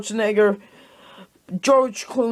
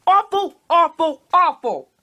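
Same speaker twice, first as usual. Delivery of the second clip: higher, louder cry of awful, awful, awful; exasperated yelling; 6 semitones higher, 3 dB louder than usual. Real, not acted. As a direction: shout it out in disgust. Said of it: "Sorry if I'm screaming too much".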